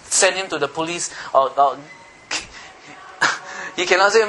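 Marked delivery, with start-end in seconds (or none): none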